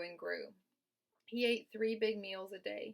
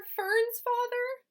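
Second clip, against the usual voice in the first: sobbed; 11 dB louder, much slower than usual. A narrator's reading. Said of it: "sobbed Wilbur".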